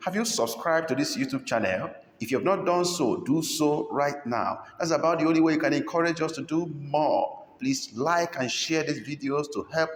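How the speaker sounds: high, eager plea to subscribe; upbeat appeal. Acted. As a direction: sell it to the viewers brightly. Channel appeal.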